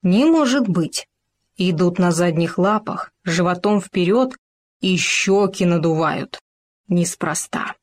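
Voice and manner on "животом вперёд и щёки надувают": usual speaking voice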